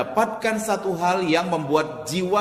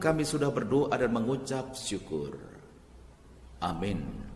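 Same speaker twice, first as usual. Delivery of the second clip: low, softer praying voice; prayer; 6 semitones lower, 5 dB quieter than usual. Real, not acted. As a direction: close a prayer in a lowered, reverent voice. Leads an opening prayer. (suara doa yang rendah dan khidmat)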